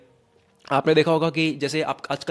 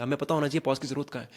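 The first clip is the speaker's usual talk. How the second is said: ordinary talk, nothing marked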